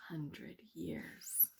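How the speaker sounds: whispered hundred years. A narrator's reading. Narrator closing the sad bit, whispered. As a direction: breathe it out slow.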